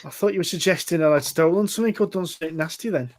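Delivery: lightly put-on Scouse patter; Lister's Scouse voice. Stranger explains his worry to the adventurer, Scouse. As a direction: play a Scouse local explaining himself. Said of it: "gonna go for a Lister voice".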